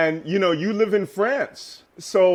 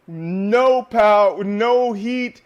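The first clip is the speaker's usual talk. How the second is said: ordinary delivery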